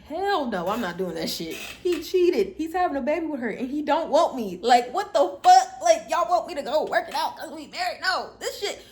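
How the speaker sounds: loud, high, indignant, laughing in places; outraged rant. Retold or imitated. Telling everyone she won't make it work with her cheating husband, loud and outraged. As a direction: let it rip, fed up and incredulous. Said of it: "And I was just like".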